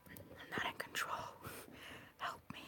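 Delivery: hushed plea for rescue, playfully helpless; mock helpless whisper. Reader at the mercy of the cards, whispering mock plea. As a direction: whisper it like a secret cry for help.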